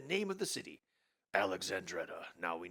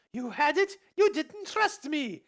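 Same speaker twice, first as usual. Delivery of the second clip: Elsa voice; 10 dB louder, slower than usual. Acted.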